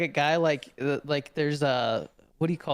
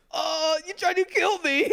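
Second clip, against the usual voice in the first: high, giggling mock accusation; laughing protest; 10 semitones higher than usual. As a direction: quote him while laughing. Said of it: "the guy with the camera's like".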